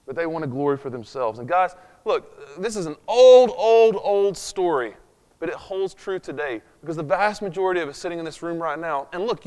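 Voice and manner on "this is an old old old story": put-on louder, slow stress on each old; emphatic repetition. Preacher stresses to the congregation how old the story is, slow and loud. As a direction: lean hard on old, old, old.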